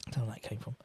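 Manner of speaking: an unvoiced embarrassed whisper to himself. Real, not acted. Streamer whispers after mixing up a name, sheepish whisper. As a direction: mutter it under your breath as a self-mocking aside.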